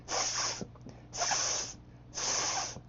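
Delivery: a soft hiss 스 with no voice; voiceless S demo. Shown as a demonstration. Teacher showing the S sound to students as a quiet hiss. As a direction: breathe out 스 through the teeth, unvoiced. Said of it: "S 발음은".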